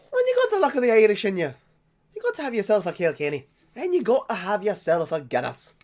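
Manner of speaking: loud, high-pitched, hammed-up Irish accent; bad Irish accent. Drinker toasting the luck of the Irish, bad Irish accent. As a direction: belt it out in a badly faked Irish brogue. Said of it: "very sorry about the bad Irish accent".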